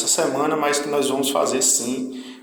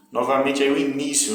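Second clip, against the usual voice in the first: ordinary talk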